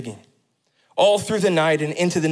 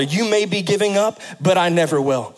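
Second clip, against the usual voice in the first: full-force preaching; 5 semitones higher than usual. Acted. Pastor assuring the congregation of God's faithfulness, preaching hard. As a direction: press each promise home.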